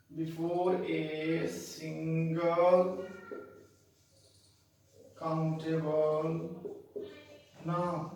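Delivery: very slow, word by word; dictation. Shown as a demonstration. Teacher repeating the rule for learners, slow dictation. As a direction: dictate slowly with long gaps between words.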